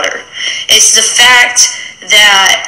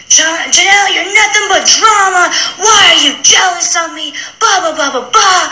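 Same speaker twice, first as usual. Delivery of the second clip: high, whiny mock voice with a laugh in it; mocking Ashley; 10 semitones higher than usual. Retelling Ashley cussing her out, in a high mocking whine. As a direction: sneer it out like a bratty teen, half laughing.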